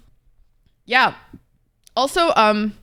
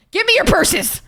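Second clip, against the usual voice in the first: higher, mock robber demanding purses; playful robber demand; 10 semitones higher, 3 dB louder than usual. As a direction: hold up the chat for their purses, playfully. Joking hold-up demand to chat.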